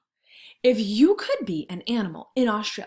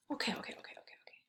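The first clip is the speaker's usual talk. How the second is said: whisper; 2 semitones higher, 16 dB quieter, much faster than usual. Real, not acted. Teacher quieting herself.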